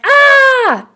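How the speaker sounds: high, loud frightened scream; a full mock scream of fright; imitated scream. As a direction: shriek like a scared little kid. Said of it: "I was like".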